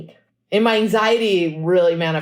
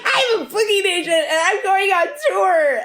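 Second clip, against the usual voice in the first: laughing excitement; 12 semitones higher than usual. Retold or imitated. Thrilled about her first tour, high laughing delight. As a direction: giggle it out with disbelief.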